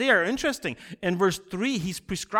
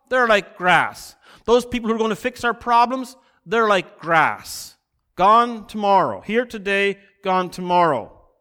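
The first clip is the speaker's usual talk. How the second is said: louder, slowed, hammering refrain; emphatic preaching; 5 semitones higher, 5 dB louder than usual. Acted. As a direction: drive the refrain home to the congregation. Preacher presses his point.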